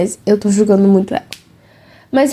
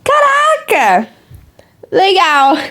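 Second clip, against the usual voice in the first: fake excitement; 7 semitones higher, slower than usual. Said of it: "Ela olhando assim".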